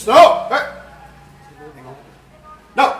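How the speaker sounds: loud, high shouted "No!" at a dog; sharp command. Shown as a demonstration. Trainer demonstrates a firm shout to stop a dog. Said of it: "suara kita bisa nyampe duluan".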